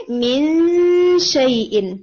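مِن شَيْءٍ recited slowly, stopping on شَيْءٍ; recitation demo. Shown as a demonstration. Teacher showing the student where to stop, slow recitation. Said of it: "یہاں پہ ہم تھوڑی دیر رکیں گے".

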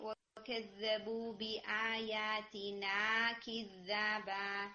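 long stretched vowels in كِذَّابًا; stretched madd. Shown as a demonstration. Teacher drawing out the madd for her student, slow recitation. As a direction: draw out the long alif in كِذَّابًا slowly. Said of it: "الف مدیہ مس ہوئی ہے".

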